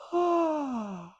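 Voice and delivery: big open-jawed yawn sigh; audible high yawn with dropped jaw; demo yawn. Shown as a demonstration. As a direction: show them a full yawn. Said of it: "we're gonna practice yawning".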